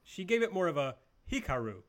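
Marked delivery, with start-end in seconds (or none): 1.3-1.8 "Hikaru" as a careful Japanese-style name; Japanese-style pronunciation. Shown as a demonstration. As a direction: imitate the announcer's more correct "Hikaru". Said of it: "correct sounding pronunciation of Hikaru Shida's name".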